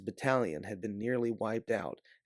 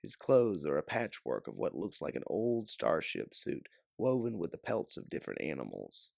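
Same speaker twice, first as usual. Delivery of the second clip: ordinary delivery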